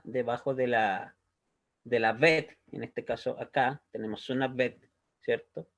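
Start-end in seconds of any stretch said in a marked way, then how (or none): none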